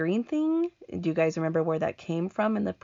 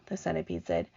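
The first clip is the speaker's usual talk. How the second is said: ordinary delivery, nothing marked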